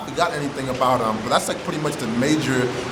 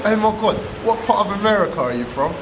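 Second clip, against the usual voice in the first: excited squeal; 7 semitones higher than usual. Retold or imitated. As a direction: gush like a starstruck fan. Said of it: "They're like".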